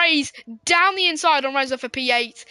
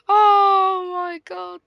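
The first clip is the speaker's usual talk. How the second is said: long drawn-out oh my god through laughter; cracking up; 4 semitones higher, much slower than usual. Real, not acted. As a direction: laugh it out in disbelief. Streamer cracks up at crash.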